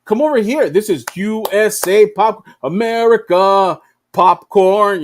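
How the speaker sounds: mock carnival barker selling American popcorn; hammed-up pushy salesman. Playground story, USA stand lures kids, mock barker. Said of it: "And the U S A is like".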